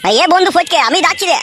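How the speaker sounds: high-pitched call to a friend. Acted. Friend hollering for his pal at the door, high call. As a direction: call him out of the house. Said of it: "এত চিল্লাচিল্লি করে ডাকিস".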